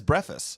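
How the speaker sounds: breakfast said as "breffist"; variant pronunciation. Retold or imitated. Co-host quoting his friend's pronunciation, breakfast as "breffist". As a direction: drop the K from breakfast, "BREFF-ist". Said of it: "he says breakfast".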